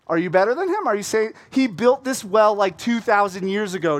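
sassy woman putting a stranger in his place; feisty challenge. Retold or imitated. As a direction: poke at his pride. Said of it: "she does a little dig at him".

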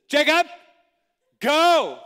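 high, loud shout with a pause; commanding teacher. Retold or imitated. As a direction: send the pupil off from afar. Teacher points and shouts from distance.

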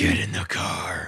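low, slow put-on voice. Co-host asked for a TV judge, low impression. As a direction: drag it out deep and creepy. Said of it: "What was [name]?".

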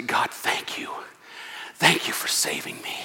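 soft, hushed, whispered thanks to God; whispered grateful prayer. Shown as a demonstration. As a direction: breathe the prayer close and humble. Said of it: "You turn to God and you say".

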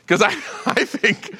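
chuckling through the words; laughing. Real, not acted. Pastor laughing at his own weakness, chuckling. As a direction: laugh it out at yourself.

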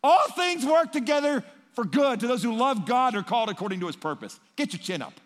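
loud, high, bossy preachy tone; preachy platitude. Retold or imitated. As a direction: lecture a grieving friend with a verse.

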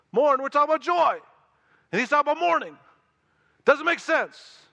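preacher rising to full force over a puzzle; fervent preaching. Acted. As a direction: hammer the paradox of joy and mourning. Preacher stresses the paradox loudly.

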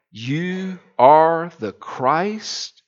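very slow, weighty declaration; solemn confession. Acted. Peter confessing to Jesus, very slow and solemn. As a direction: let each word land.

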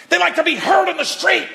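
pitched high and loud like a street brawler; a quick mock shout; mock loud arguer. Shown as a demonstration. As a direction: blare it like someone who wants to be heard.

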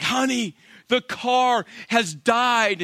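hammed-up distress, dragging out the bad news; mock panic. Wife phoning husband about the broken-down car, mock panic. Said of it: "she's in a complete panic".

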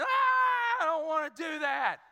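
hammed-up high, loud wail into a protest; imitated child's scream. Dad imitating son at sight of the needle, screaming protest. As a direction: scream it like a panicking kid, very high. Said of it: "He screamed and hollered".

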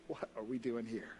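low, soft, humbled amazement; hushed wonder. Acted. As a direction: let it sink to a quiet, wondering question. Humbled believers marvel at heaven.

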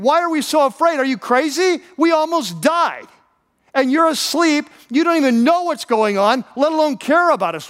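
high, incredulous protest; acted outraged questioning, pitched well up; indignant disbelief. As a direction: protest like someone who almost drowned. Imagines himself as indignant disciple.

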